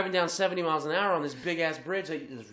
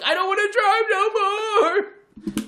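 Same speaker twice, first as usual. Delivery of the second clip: high, loud crybaby whine; hammed-up sobbing plea; mock tearful wail; 7 dB louder than usual. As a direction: wail it like you've reached breaking point. Acting out breaking down from fear.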